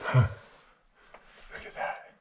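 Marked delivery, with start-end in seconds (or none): 1.5-2.1 soft awed whisper; hushed look at that. Real, not acted. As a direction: barely breathe it out. Awed whisper exploring mine.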